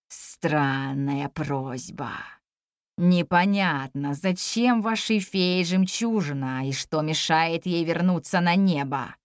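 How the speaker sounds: very low, slow dragon voice; ponderous dragon. Acted. Dragon answering the girl's request, ponderous. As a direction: draw the words out low, like a puzzled old beast.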